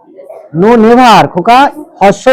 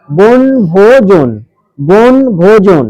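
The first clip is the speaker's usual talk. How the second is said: বন ভোজন very slowly, split as bon, bho, jon; syllable by syllable; much slower than usual. Shown as a demonstration. Teacher splits a word for the class to count syllables, slow and segmented. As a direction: draw out each syllable of বন ভোজন. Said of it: "বনটা যখন দেবো, বনের পরে দন্তানের নিচে একটা হসন্ত দে".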